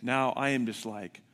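ordinary talk, nothing marked

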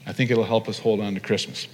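ordinary delivery

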